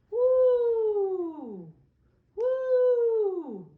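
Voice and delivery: very high, loud wind howl; storyteller howling as the wind. Acted. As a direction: blow like a big gust of wind.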